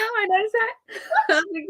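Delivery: giddy laughter, nearly squealing; delighted fan laughing while talking. Real, not acted. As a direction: laugh through it, pitch up high. Giddy over being noticed.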